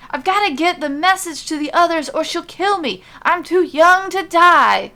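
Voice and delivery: high, loud terrified plea, slowing at the end; desperate panic. Acted. Messenger trapped in the room, panicked and pleading. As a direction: beg for your life.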